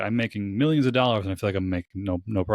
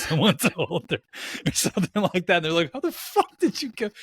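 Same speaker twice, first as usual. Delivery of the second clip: high-pitched, laughter breaking through the words; squeezing the words out through real laughter; laughing while talking; 9 semitones higher than usual. Real, not acted. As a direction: crack up as you tell the punchline. Cracking up at own anecdote.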